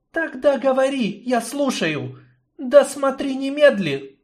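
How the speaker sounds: high, firm woman's voice; commanding woman. Acted. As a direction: order him to talk. Yarina commands the snake.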